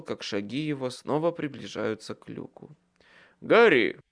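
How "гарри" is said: loud call from upstairs. A narrator's reading. Michael calling Harry from upstairs, loud call. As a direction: call his name down through the hatch. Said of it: "окликнул меня Майкл".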